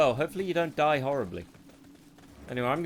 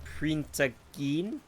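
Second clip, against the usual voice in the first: bad New Zealand accent; much slower than usual. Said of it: "In a bad New Zealand accent?".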